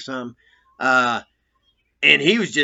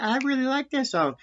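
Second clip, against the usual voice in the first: high, softer put-on voice of Judy; lightly mock the supervisor's approval; imitating Judy; 10 semitones higher, 3 dB quieter than usual. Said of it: "Judy, I remember specifically, said".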